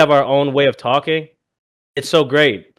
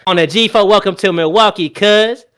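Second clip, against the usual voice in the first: higher, louder Milwaukee street voice; Milwaukee accent; 5 semitones higher, 4 dB louder than usual. Pretend. Showing off the Milwaukee accent to friends, louder and higher. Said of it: "your Milwaukee voice".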